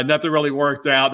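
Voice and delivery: ordinary delivery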